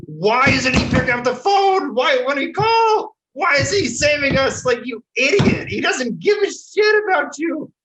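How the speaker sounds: high, loud outraged shouting; screaming. Retold or imitated. Senator yelling at his staff over an unanswered phone, screaming. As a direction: yell it like a boss berating staff. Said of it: "like, screaming at his staff".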